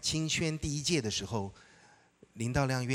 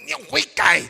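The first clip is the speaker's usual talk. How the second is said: much higher, loud commanding call; preacher's shout; 14 dB louder, faster than usual. Retold or imitated. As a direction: call it out across the hall. Preacher calls out to him. (高声大喊)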